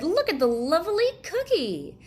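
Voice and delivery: put-on high, loud eager boy; a boy thrilled by a treat; delighted child. Boy finding a cookie on his chair, high and delighted. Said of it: "he said".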